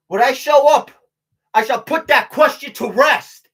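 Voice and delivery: loud, slow, emphatic proclamation; proclaimed motto. Pretend. Tells viewers his new motto, loudly proclaimed. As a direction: belt it out like a battle slogan.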